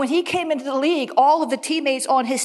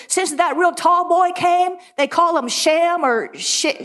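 slow, higher Alabama grandmother drawl; thick Alabama accent; 6 semitones higher, slower than usual. Retold or imitated. Grandmother fumbling for Shaq's name, imitated slow Alabama accent. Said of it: "she had this really thick".

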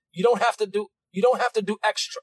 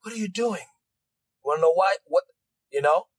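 normal talking voice

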